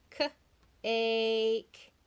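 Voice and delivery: K-ake with the long A drawn out; stretched A sound. Shown as a demonstration. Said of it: "say the A sound".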